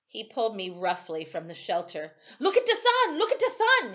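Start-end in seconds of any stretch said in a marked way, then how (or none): 2.4-3.9 high, loud commanding shout; urgent order. Acted. Timothy orders the blind boy, high and loud. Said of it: "he ordered".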